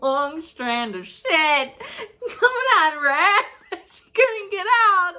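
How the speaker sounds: laughing through the words; giggling while telling it. Real, not acted. Laughing while telling gross story.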